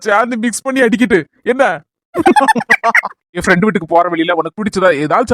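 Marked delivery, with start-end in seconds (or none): 2.1-3.1 high-pitched giggle; laugh. Acted. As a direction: burst into a short high laugh. Joke about feeding the cow. (உயர்ந்த குரலில் சிரிப்பு)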